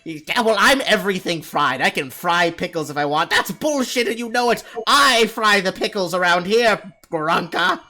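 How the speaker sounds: high, loud squabbling goblin; goblin yelling. Acted. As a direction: yell back, outraged and petty. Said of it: "yelling at each other".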